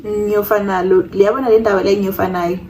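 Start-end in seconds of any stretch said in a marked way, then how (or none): none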